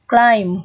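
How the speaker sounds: "climb" ending on M, the B left silent. Shown as a demonstration. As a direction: say "climb" closing on a plain M. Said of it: "âm bờ này sẽ là âm câm, không đọc này". (đọc "climb" kết thúc bằng âm M, âm B câm)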